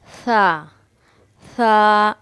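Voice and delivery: tongue on the teeth, no whistle in the sa; teacher's demo sound. Shown as a demonstration. Urdu teacher showing a lisped sa to students. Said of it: "ये दांत से हम ऐसे लगाकर पढ़ेंगे".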